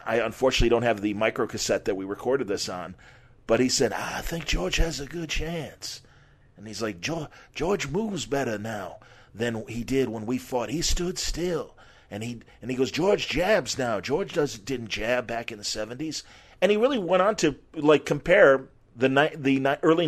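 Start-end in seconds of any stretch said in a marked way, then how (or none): 3.9-6.0 imitating Ali's soft, slow slur, a touch softer; slightly slurred Ali. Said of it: "speak to me in a slightly slurred voice". 7.0-16.2 soft, slurred Ali impression, softer; imitating Ali. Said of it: "I'm gonna imitate it a little bit".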